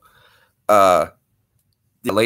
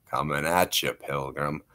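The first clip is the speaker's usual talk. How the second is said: lower, softer drawled cowboy; John Wayne; 8 semitones lower, 10 dB quieter than usual. Retold or imitated. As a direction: do the Duke. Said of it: "You want a little John Wayne?".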